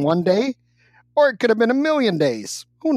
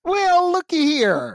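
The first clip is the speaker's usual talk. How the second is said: hammed-up Southern drawl, higher; mock Southern accent; 7 semitones higher, slower than usual. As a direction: put on a cowboy twang. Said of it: "I can speak as well Southern".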